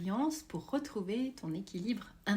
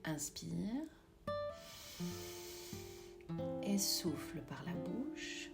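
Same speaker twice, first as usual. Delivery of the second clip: guided meditation; 9 dB quieter than usual. Acted. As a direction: guide them gently into calm.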